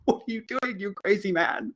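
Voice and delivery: high, hushed mock alarm; comic uneasy disbelief; terrified grocery boy. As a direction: softly protest as the nervous kid. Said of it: "He looks terrified".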